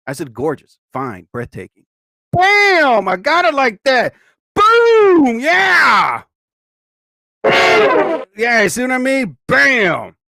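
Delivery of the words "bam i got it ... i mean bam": hype shout. Real, not acted. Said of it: "hype shout".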